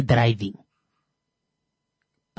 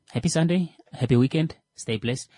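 normal talking voice

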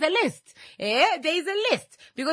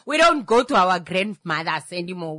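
ordinary talk

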